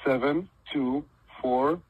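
each digit slow and separate; dictating slowly. Shown as a demonstration. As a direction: spell out the digits one by one, slowly. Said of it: "I want you to say it like this".

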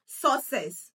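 "success" said as SAW-sess, stress on the first syllable; wrong pronunciation. Shown as a demonstration. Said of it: "this word is not pronounced as".

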